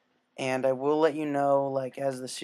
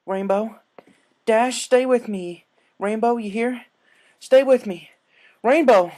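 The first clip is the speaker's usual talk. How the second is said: loud, higher panicked pleading; desperate shouting; 9 semitones higher, 11 dB louder, slower than usual. Acted. Applejack yells at the dying Rainbow to stay, loud and panicked. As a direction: scream her name to keep her awake.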